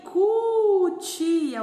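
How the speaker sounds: "cutia" high, loud and slow; syllables sounded out. Shown as a demonstration. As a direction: hold the "cu" of "cutia". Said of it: "o C e o U".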